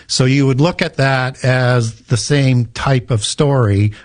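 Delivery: usual speaking voice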